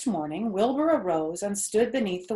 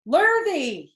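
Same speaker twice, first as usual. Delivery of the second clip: high, loud call across the barn; 8 semitones higher, 7 dB louder than usual. A narrator's reading. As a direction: call Lurvy from a distance. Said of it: "he called".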